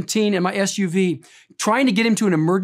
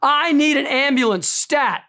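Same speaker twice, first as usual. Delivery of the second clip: raised, urgent call of the nurse; mild mock yell; 7 semitones higher, slower than usual. Nurse shouting down the hallway, mildly voiced. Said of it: "screaming down the hallway".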